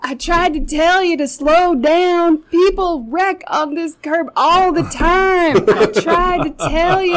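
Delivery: high, loud smug told-you-so; high loud mocking I-told-you-so; smug creep. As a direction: gloat at the injured girl. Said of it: "he's like".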